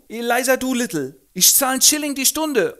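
sehr hoch, laut, prollig; Straßengöre. Acted. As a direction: patzige Göre im Jugendslang. Eliza stellt sich vor. (hohe, laute Prollstimme)

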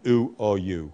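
oo said long and low; long vowel sound. Shown as a demonstration. Teacher modelling the long vowel oo for pupils. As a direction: hold the oo in oo or u. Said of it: "it has to be the long vowel sound".

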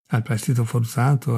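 ordinary delivery

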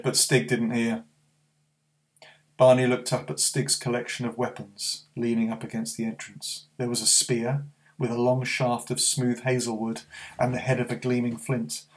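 plain reading voice

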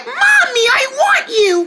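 put-on high, whiny baby wail for mommy; mock baby crying. Mother imitating her baby daughter's old crying, mock wail. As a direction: imitate a crying little one calling mommy. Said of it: "Like, really loud".

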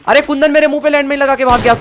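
high indignant outburst; outraged shout. Real, not acted. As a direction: yell in gaming outrage. Outraged gamer after landmine.

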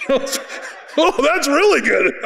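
high, laughing self-praise; tickled and laughing at his own words; laughing delight. Retold or imitated. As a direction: chuckle through it, amazed. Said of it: "'cause I'm like".

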